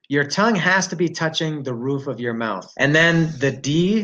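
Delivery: usual speaking voice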